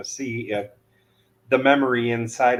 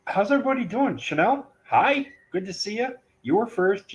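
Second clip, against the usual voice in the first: high bright greeting; 10 semitones higher, faster than usual. Real, not acted. Greeting chat viewers.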